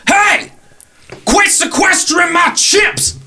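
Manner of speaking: shouted high, slow on the complaint; angry shout. Acted. Chimp owner yelling at the stranger, high angry shout. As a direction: yell it from behind like an offended owner. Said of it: "you hear a voice from behind you go".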